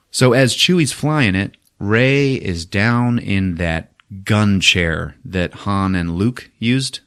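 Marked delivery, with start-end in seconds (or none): none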